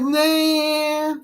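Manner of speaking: high, put-on dismissive nah; mock refusal. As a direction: brush it off in a squeaky voice. Customer refusing in retold video.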